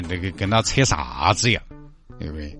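usual speaking voice

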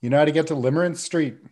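calling across the street. Acted. Cull calls to the stranger across the street, loud. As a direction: shout it across the road.

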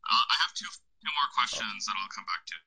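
distant, tinny mic sound; far from mic. Real, not acted. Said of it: "You sound very distant suddenly".

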